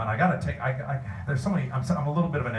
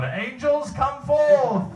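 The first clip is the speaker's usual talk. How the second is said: high, booming theatrical summons; sketch angel summoner; 6 dB louder, much slower than usual. Retold or imitated. Fan quoting a comedy sketch to the panel, grand mock invocation. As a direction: call down the angels, hammed up and drawn out. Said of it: "I love the Angels Summon".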